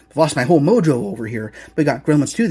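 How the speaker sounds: normal talking voice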